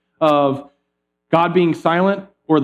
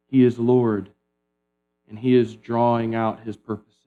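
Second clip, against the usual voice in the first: ordinary talk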